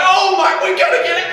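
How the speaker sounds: very high, loud mock panic; freaking out. Sibling in a burning house, freaking out. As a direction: shriek it in comic alarm. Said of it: "freaking out, like".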